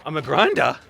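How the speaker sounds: incredulous query, punching "grinder"; puzzled question. Real, not acted. Vlogger told a bear label, puzzled and questioning. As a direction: question the word "grinder" as if misheard.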